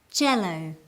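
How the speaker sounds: jello with a firm J; J sound. Shown as a demonstration. Teacher having students repeat jello, firm J. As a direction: jello starting like juice. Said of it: "Repeat with me".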